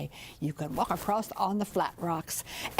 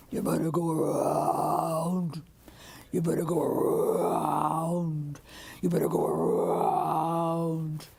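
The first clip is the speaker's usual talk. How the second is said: lower, slow, deep croaking warning; old bullfrog croak; 6 semitones lower, slower than usual. Acted. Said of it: "that old granddaddy bullfrog would call back".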